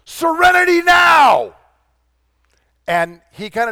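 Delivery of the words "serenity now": loud, high drawn-out shout; sudden full-lunged yell, far above speaking pitch; shout at top of lungs. Acted. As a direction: bellow it as an exasperated father, stretching the words. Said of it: "his father shouts out at the top of his lungs".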